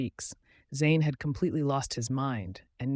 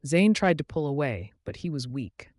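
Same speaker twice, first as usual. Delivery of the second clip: ordinary delivery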